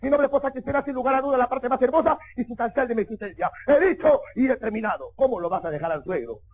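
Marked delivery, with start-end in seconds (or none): 0.0-5.1 formal proposal rattled off without pause; rapid-fire speech. Acted. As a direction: race through a pompous memorised address. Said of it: "Peor si le hablas rápido".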